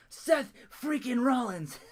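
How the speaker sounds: laughing mock Rollins; Seth Rollins impression. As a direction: drag his name out, laughing, in his voice. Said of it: "pretty good Seth Rollins impression".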